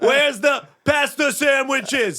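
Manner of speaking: high, slow, jolly party voice; hammed-up folksy host; mock cheery relative. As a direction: play a dismissive uncle at a cookout. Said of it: "And I was like".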